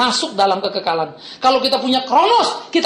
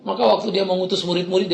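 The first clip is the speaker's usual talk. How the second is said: ordinary talk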